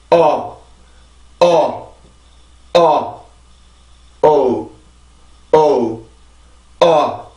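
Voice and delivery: isolated '어' vowels, each falling; tense the first '어', release into the second; '어' drilled with careful lip shape and pauses; diphthong drill. Shown as a demonstration. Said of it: "주의해서 발음합니다".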